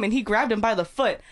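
ordinary talk

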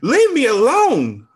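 high, loud, slow panicked scream. A narrator's reading. Old woman caught by staff, flustered scream. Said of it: "I screamed".

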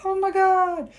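high startled squeak; shocked high exclamation; mock alarm. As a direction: gasp it out, high. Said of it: "And you're like".

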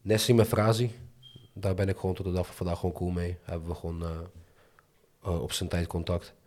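ordinary talk

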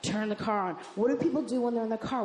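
ordinary talk

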